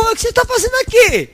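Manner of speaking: high, quick startled voice; surprised greeter. Acted. Heavenly greeter startled at an early arrival, high and quick. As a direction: act shocked someone showed up too soon.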